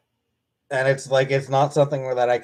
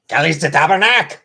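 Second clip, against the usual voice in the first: louder Québécois swear Kalis de Tabarnak; Québécois curse; 3 semitones higher, 8 dB louder than usual. Shown as a demonstration. Speaking French for chat, loud Québécois swear. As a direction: belt out the Quebec curse. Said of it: "Speak French".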